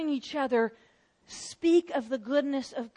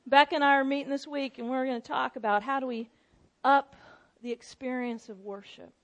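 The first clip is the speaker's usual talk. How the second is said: usual speaking voice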